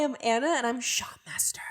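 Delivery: ordinary talk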